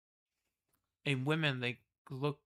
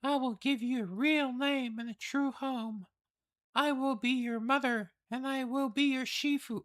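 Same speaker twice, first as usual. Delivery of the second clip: high, wondering noble voice; awed noble lady; 12 semitones higher, 4 dB louder than usual. Acted. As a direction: marvel at a hidden talent. Reads palace lord's lines.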